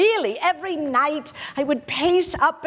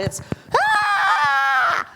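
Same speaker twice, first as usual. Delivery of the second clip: retold scream; 3 semitones higher than usual. Actress reliving her fright at the Mouth of Truth, short mock scream. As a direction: reenact the startled shriek at the statue. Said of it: "the scream I let out was good and proper".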